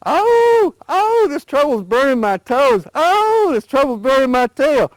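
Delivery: high, loud yelps of burning pain; hollering in pain. A narrator's reading. Burned alligator hollering as he jumps around. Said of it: "jump up and down and holler".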